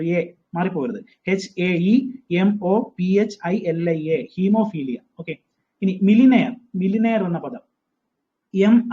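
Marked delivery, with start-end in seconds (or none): 1.3-4.3 each letter said slowly and separately; teacher spelling out the word slowly. Shown as a demonstration. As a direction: dictate letter by letter. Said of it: "തെറ്റിപ്പോകരുത്".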